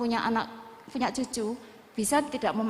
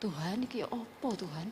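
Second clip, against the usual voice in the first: soft, helpless plea to God, retold; tearful plea; 3 semitones lower, 9 dB quieter than usual. Mother praying over her feverish child, softly tearful plea. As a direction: quietly re-enact a weepy prayer.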